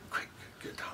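hushed, urgent whisper, imitating a boy; imitated whisper. Investigator copies children heard whispering to each other, imitated whisper. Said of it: "I tried to whisper what, what he was whispering".

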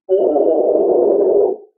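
low loud voiceless scream; lowest scream. Shown as a demonstration. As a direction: scream as low as you can. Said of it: "This is the lowest I can scream".

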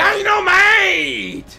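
high, loud, very slow holler; drawn-out mock yell. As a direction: stretch it into a big comic shout. Reacting to dynamite in game.

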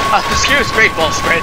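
sarcastically polite to a monster; mock polite.

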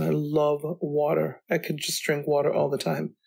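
ordinary delivery

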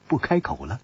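plain reading voice

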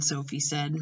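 usual speaking voice

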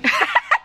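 very high, very loud squeal of a laugh; high laughing yelp; real shriek of laughter. Real, not acted. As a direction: burst out squealing. Laughing at mother's answer.

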